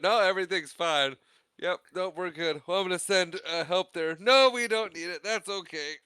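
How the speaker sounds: high-pitched mock nervous reassurance; frantic bluffing. Han fending off help over the radio, mock frantic. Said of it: "kinda frantic and weird as possible".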